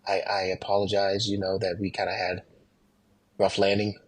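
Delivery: soft apology. Retold or imitated. Pilot greeting scared passengers, quiet sincere apology. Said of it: "I wanna say".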